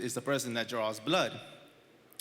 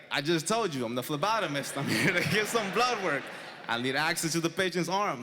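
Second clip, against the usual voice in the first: raised, emphatic retelling of an introduction; insistent repeat; 4 semitones higher than usual. Retold or imitated. Phlebotomist answering staff who asked who they are, insistent repeat. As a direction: press the point, voice climbing toward the end. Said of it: "Who are you?".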